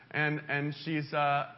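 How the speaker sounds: ordinary talk, nothing marked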